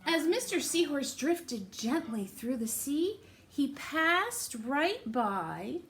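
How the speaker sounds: lively, sing-song storybook reading; picture-book read-aloud voice; reading to children. Acted. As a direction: read it to little ones with big rises.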